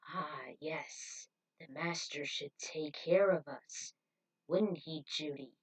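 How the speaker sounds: dazed and delirious. Acted. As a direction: drift off like a blissed-out cult convert. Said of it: "dazed but deliriously happy".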